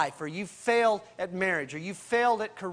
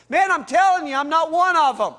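loud, high, insistent protest; cornered man yelling that he's not one of them; heated denial; 8 semitones higher, 11 dB louder than usual. Retold or imitated. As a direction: shout the denial at the accusers. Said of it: "he swears, he curses".

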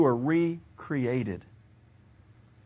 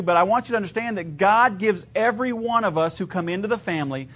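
ordinary talk

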